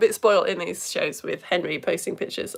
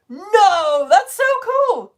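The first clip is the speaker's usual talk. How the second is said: excited; 10 semitones higher, 10 dB louder, much slower than usual. Real, not acted. Reacting to a viewer's message.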